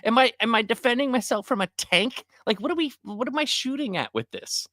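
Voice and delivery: baffled at the huge gun; high mock disbelief; incredulous. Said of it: "I was like".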